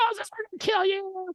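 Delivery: very high, squealing mock anger; hammed-up threat in a squeaky crowd voice; mock pissed-off. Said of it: "They're all pissed".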